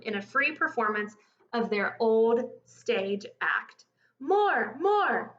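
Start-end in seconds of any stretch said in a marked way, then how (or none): 4.2-5.4 high, eager calls for more; delighted calling out. A narrator's reading. Shoppers delighted by the crocodile act, calling out. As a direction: cheer like a thrilled shopper. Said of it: "the surprised but delighted shoppers called out".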